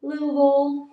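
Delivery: Southern-accent Louisville, swallowed to two syllables. Shown as a demonstration. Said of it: "that's how they say it in the South".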